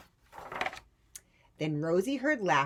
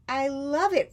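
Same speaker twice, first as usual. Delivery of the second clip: loud, high hoot of glee; hooting delight; 10 semitones higher, 10 dB louder than usual. Acted. Fred hooting loudly at Rosie's cheese hat, gleeful. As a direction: whoop it out, thrilled. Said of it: "Fred hooted".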